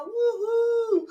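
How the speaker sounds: high joyful whoop; excited whoop. Retold or imitated. Livestream greeting.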